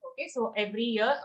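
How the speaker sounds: ordinary talk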